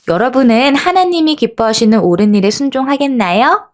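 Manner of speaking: ordinary talk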